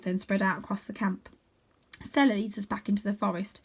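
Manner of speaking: usual speaking voice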